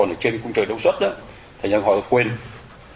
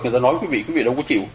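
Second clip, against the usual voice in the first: ordinary talk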